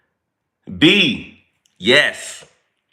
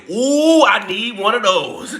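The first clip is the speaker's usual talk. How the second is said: retold excitement; 8 semitones higher, slower than usual. Streamer retelling how he spotted an old man's knife holder, mock-excited. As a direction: act out coveting it on sight.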